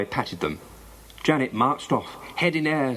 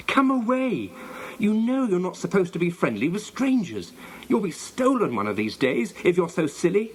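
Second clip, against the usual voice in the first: high-pitched cross scolding; furious; 6 semitones higher than usual. A narrator's reading. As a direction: scold the dog, starting high and easing slightly. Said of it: "Janet suddenly felt furious".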